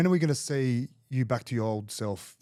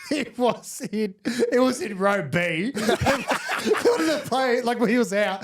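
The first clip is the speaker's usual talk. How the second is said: laughing; 10 semitones higher, slower than usual. Real, not acted. Laughing telling story.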